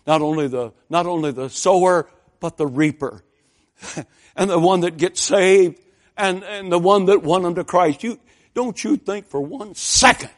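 loud preaching, climbing in pitch; rising preaching. Acted. Preacher promising the congregation joy for sower and reaper, rising preaching.

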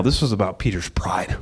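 hushed, unvoiced whisper. Real, not acted. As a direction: lean in and whisper the point. Preacher drops to a whisper.

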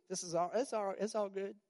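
airy high mock cheer; mock carefree pretending. Preacher mocking pretending all is fine, high and airy. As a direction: brush it off like nothing happened. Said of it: "Like the video".

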